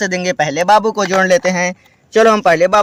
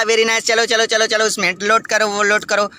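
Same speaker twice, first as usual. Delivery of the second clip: ordinary delivery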